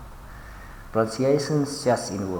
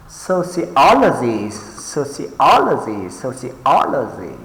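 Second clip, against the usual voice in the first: sociology with OL punched, then falling; soci-OL-ogy, leaning on OL; stress on correct syllable; 2 semitones higher than usual. Shown as a demonstration. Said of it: "mark the stress on the correct syllable".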